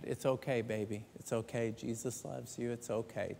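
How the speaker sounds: soft, hushed motherly comfort; mother soothing. Retold or imitated. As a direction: pat his hand and soothe him gently. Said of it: "He started tapping his hand".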